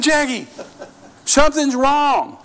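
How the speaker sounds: high-pitched, drawn-out comic exasperation; mock-exasperated pleading; exasperated insistence. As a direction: play the husband who won't be put off. Retold husband insisting to wife.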